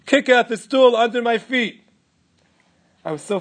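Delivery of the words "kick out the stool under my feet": loud, higher commanding yell; father screaming. Retold or imitated. Father ordering his son to kick the stool, screaming command. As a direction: order him with everything you have. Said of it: "My father screamed".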